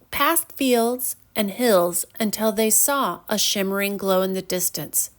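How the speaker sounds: usual speaking voice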